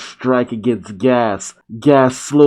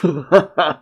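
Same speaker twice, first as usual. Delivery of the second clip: short, higher mock laugh; acted laugh; 5 semitones higher than usual. A narrator's reading. Gas relaxing as his rival passes out, laughing. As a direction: let out a put-on victor's laugh. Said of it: "just laughs".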